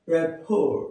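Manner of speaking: রিপোর্ট with silent final t; correct pronunciation. Shown as a demonstration. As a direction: say রিপোর্ট as "re-pho". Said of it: "এর উচ্চারণ".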